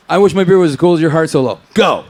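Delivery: loud, fast shouted challenge; yelling a dare from the crowd; mock shouted demand. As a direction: bark it out like a rowdy fan daring him. Hypothetical fan demanding a solo.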